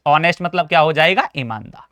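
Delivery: usual speaking voice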